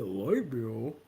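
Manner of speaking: low, baffled clerk voice; puzzled store clerk. Retold or imitated. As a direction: imitate a clueless boy, rising question. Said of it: "He said".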